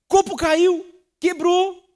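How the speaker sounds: "Copo caiu, quebrou" cried out high and loud in mock exasperation; exaggerated outburst. Shown as a demonstration. Pastor mimicking an irritable person over a broken glass, high loud mock outburst.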